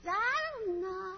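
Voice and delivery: put-on high, slow little-kid shrug; toddler voice. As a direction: play a clueless tiny boy. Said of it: "child answers".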